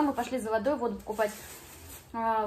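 usual speaking voice

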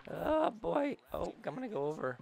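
ordinary talk, nothing marked

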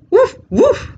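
very high loud yip; dog bark. Acted. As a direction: yip like an excited puppy. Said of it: "says the dog".

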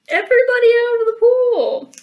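high, loud lifeguard holler; calling out. Acted. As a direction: yell it to clear the pool. Plug pulled, calling everyone out.

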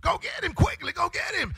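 high, loud shouted commands as Satan; Satan sending imps. Acted. Satan urging his imps to attack the doubter, shouting. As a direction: bark orders at the imps as the devil. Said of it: "Satan will begin to send in his imp".

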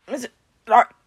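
broken, breath-starved words; choking out words between gasps; gasping. A narrator's reading. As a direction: struggle to get the name out. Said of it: "Peter gasped".

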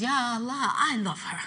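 quiet. Acted. Jibreel's quiet reply.

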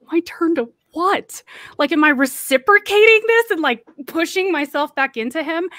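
high, loud retold bafflement; incredulous disbelief. Young woman inwardly reacting to his whispered invitation, incredulous. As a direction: replay a startled, appalled inner question.

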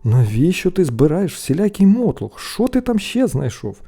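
a touch of anger; cross questioning. A narrator's reading. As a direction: scold with growing impatience. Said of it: "сердито вже спитали брати".

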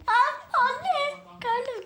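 sudden high yelp of alarm; a jolt of fright mid-spin; startled cry. Real, not acted. Kid spinning on a chair, startled cry.

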